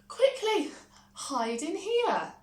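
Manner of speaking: high, loud hurry; urgent. A narrator's reading. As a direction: rush him into hiding. Giantess hides Jack urgently.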